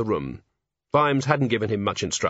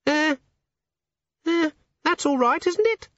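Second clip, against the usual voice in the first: very high, unsure squeaky imp; tiny nervous creature voice. Acted. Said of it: "it said".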